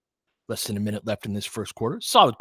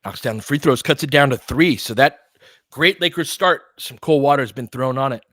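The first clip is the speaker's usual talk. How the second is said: rising excitement in the call, louder; live play-by-play; 8 dB louder than usual. Real, not acted. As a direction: call the action as it happens, voice up.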